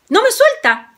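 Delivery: quejido agudo de susto; Juan asustado. A narrator's reading. Juan mordido por el perro. (Juan mordido pide ayuda, voz aguda asustada)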